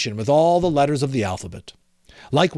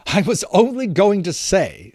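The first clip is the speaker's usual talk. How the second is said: put-on lightly raised, lively young nephew voice; cheerful nephew; 6 semitones higher, 4 dB louder than usual. A narrator's reading. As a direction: play the merry nephew, higher and bright with fun. Nephew's voice in the story.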